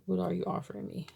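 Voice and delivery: ordinary talk, nothing marked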